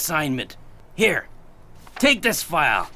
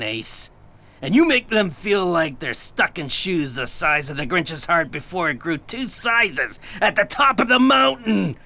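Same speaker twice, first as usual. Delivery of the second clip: ordinary delivery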